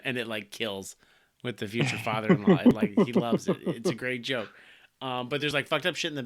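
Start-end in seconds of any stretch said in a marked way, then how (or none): none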